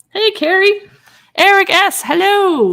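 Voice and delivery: greeting viewers by name, thrilled; excited greeting. Real, not acted. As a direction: call out a delighted welcome. Greeting live chat viewers excitedly.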